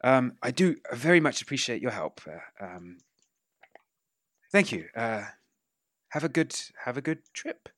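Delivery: soft, lightly voiced old man's thanks; quietly grateful old gentleman; Vito Sessa. Old man softly thanking the player for the help. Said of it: "Vito Sessa, uh, says".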